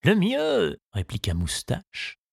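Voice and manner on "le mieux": lightly voiced old gardener, solemn and pitched up. The gardener telling Tistou the news, with a light old-man voice. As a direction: deliver the verdict gravely, a hint of an old man.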